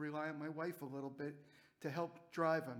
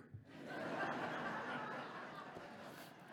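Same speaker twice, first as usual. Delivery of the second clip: breathless high silent laughter; real laugh; 14 semitones higher, 6 dB quieter than usual. Real, not acted. Remembering Jacob asking for beer, helpless breathy laughing. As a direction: crack up at the memory, almost soundless. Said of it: "realized he was asking for a beer".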